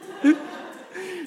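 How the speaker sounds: high giggle; laugh. Real, not acted. Preacher promises it is the last one, giggling. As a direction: giggle before the last round. Amused at own repeated joke.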